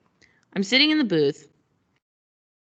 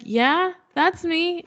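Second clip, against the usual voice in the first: sheepish yes; 9 semitones higher, much slower than usual. Retold or imitated. Admits being the host.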